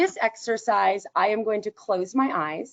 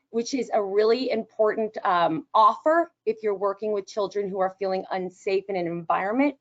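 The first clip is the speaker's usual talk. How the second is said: usual speaking voice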